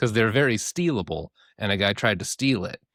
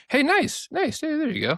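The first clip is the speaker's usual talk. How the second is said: high-pitched congratulatory squeal; playful cheering. Real, not acted. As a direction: cheer them on in a little high voice. Cheers after admitting he did it.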